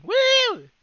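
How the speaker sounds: high loud whoop; excited whoop. Real, not acted. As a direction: cheer it out. Celebrating summer coming.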